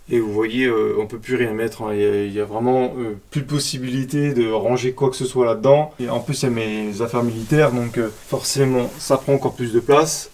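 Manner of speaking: hushed voice, keeping it down; speaking quietly. Real, not acted. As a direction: talk softly so the neighbours can't hear. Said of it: "Je peux pas parler fort parce qu'il y a les voisins".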